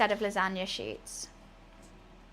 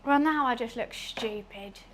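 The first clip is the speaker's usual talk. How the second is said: normal talking voice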